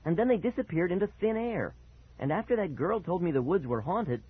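high, animated insistence; worked up. A narrator's reading. Boy pleading to be believed by dad, worked up. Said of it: "getting all worked up again".

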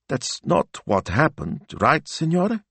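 slow plea rising high at the end; trembling. A narrator's reading. Assistant turns to her boss for reassurance, trembling. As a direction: seek reassurance, close to tears. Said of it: "her chin still trembling".

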